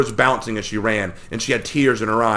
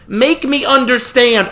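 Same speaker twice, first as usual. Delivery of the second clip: put-on high, loud pleading girl's voice, slow at the end; distraught girl; 7 dB louder, slower than usual. A narrator's reading. Sister pleads with her brother, distraught girl. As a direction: plead with him near tears.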